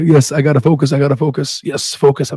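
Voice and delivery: usual speaking voice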